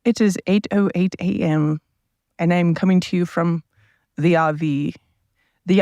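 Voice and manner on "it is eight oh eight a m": ordinary delivery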